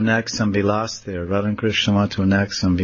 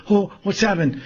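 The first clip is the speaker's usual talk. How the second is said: high startled question; acted startled fright; 10 semitones higher than usual. Radharani startled by the branch, high alarmed voice. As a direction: play mild alarm in a raised voice. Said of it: "so startled and frightened".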